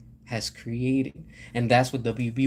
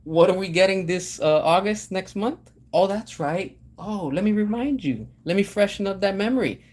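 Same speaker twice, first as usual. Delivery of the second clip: normal talking voice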